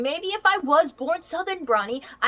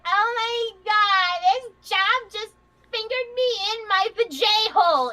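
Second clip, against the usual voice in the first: higher, drawn-out "Oh my God"; exaggerated, slow mock complaint in a raised voice; mocking put-on voice; 6 semitones higher than usual. Californian mocking co-host's idea of regional sayings, mocking. Mocks how she'd supposedly talk.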